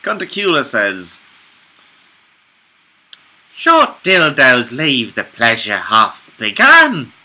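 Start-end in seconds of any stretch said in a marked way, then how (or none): 3.5-7.1 character voice. Acted. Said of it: "I cannot remember what Cuntacula sounds like".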